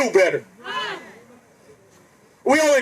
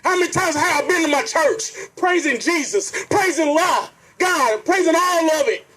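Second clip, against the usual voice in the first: ordinary talk, nothing marked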